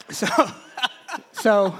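usual speaking voice

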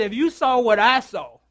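slow, loud proclamation; ringing slow declaration; proclaiming. Retold or imitated. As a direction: announce it slowly with force. Friend's words proclaimed about glory.

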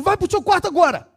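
high, loud angry shout at the son; pai gritando. Retold or imitated. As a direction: bark the order like a furious father. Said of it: "gritando, gritando, gritando". (pai gritando com o filho, bem agudo e alto)

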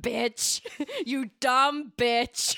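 slow gloating laughing jeer; laughing taunt. Real, not acted. Gloating after winning.

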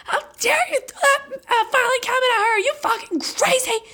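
imitating mom's outrage. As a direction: play mom losing it, crying and furious, hammed up. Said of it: "my mom was like".

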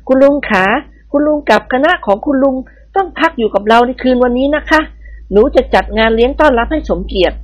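put-on young woman sweetly apologizing to her uncle; sweet young lady. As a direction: charm the old lord. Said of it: "ยิ้มอ่อนหวาน".

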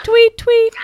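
high bird chirp "tweet, tweet"; birdsong. Pretend. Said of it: "Only joking. It's not a bird".

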